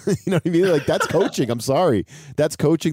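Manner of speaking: high, loud, laughing through it; excited laughing. Real, not acted. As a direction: crack up and get fired up. Laughing, hyped about coaching.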